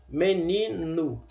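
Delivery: Menino said slowly with the final vowel as U; slow meninu. Shown as a demonstration. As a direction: draw out Menino, ending in U. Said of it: "Com o, o O aqui, ó. Como se fosse U".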